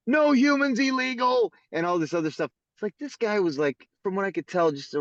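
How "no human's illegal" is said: high-pitched mock protest yell; protester's yell. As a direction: call it out like a heckler, pitched high. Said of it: "from the other side yells".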